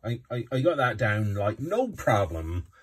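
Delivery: loud, swooping pirate brogue; pirate accent. Shown as a demonstration. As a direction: show off a rolling West Country pirate accent. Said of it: "I got a pirate accent".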